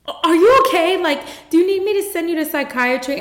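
high-pitched, alarmed question, speeding up; imitated alarm. Doctor reacting to her sobbing patient, imitated alarm. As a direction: blurt it out like a flustered doctor.

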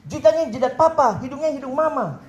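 playful retold outburst; indignant father. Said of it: "Tiba-tiba papanya marah dari sebelah".

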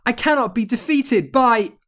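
high, loud disbelief; hammed-up wicked witch, cut off mid-cry; witch's outraged cry. A narrator's reading. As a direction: wail like a villain going down.

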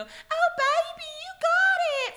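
very high, loud, slow cheer; cheering from the shore. Retold or imitated. Widow cheers husband from shore, very high and slow. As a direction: call it out like a sweet cheer from the shore. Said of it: "I was gonna go".

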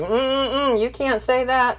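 high, sing-song mock scolding. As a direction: mimic a prissy censor wagging a finger. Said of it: "They've told you".

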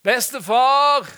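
imitated grandchild's loud, high yell; long, drawn-out high shout; calling out. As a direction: holler for Grandpa from another room. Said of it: "hørte jeg en som ropte".

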